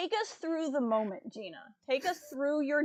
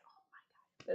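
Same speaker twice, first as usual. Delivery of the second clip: barely audible, breath-only exclamation; awestruck whisper; 14 dB quieter, much faster than usual. Retold or imitated. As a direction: whisper it as if stunned. Retold hushed shock at unmasking.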